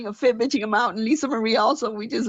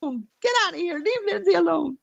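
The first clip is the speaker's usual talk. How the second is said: upset telling-off; 8 semitones higher than usual. Retold or imitated. Retold telling-off with a cry.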